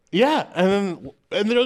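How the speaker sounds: usual speaking voice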